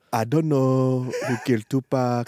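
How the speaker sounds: put-on slow African-accented English; Francis Delgado. Co-host repeats his line on request, mock African accent. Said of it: "Say that exact same sentence as Francis Delgado".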